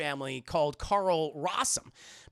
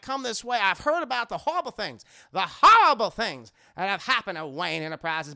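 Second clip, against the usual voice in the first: put-on thick Southern accent; 6 dB louder than usual. Rossum assuring Bruce his company is innocent, Southern bluster. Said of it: "He has a very thick Southern accent".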